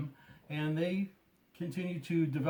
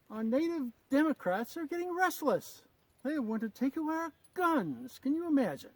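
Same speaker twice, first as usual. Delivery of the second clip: higher, put-on English accent of a colonial officer; 10 semitones higher, 4 dB louder than usual. Gunsmith's skit as an old British officer: English accent. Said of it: "try not to break into an English accent".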